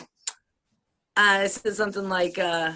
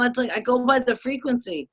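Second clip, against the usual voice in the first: normal talking voice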